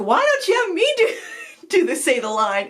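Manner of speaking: put-on high-pitched exasperated old lady; impatient, laughing through it; annoyed wife. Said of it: "she's like".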